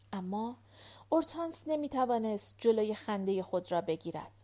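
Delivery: plain reading voice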